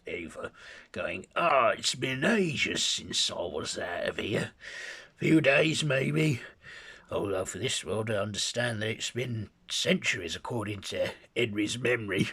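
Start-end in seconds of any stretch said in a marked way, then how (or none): 1.3-12.3 high, loud Cockney brute; gloating Hyde. Acted. Hyde boasting to Ava as he fights, gloating Cockney brute. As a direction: relish every word like a freed beast.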